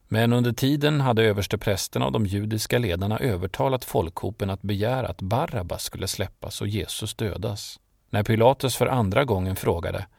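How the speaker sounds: usual speaking voice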